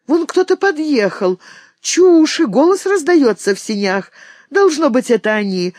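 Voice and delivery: put-on the girls cry out that the guests have come; sudden joyful exclamation; excited cry. A narrator's reading. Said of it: "вскричали девушки".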